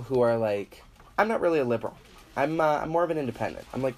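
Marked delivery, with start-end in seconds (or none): 1.2-3.7 mocking whiny denial. Mocking liberals who deny it.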